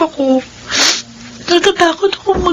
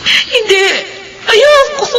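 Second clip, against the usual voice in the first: rising tearful cry, higher on Ayoko; crying protest; 6 semitones higher, slower than usual. Acted. Widow begging dead husband not to leave, crying. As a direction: break into a pleading cry.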